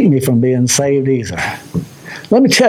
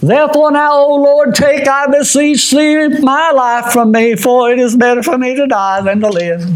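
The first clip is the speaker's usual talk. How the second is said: scripture reading; 8 semitones higher than usual. Acted. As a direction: beg for death in old Bible words. Reading Jonah's scripture plea.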